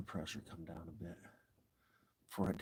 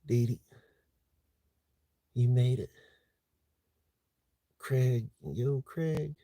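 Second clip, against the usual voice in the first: higher, louder calling of names; excited greeting; 4 semitones higher, 6 dB louder, slower than usual. Real, not acted. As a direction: greet each arrival brightly. Greeting viewers joining the stream.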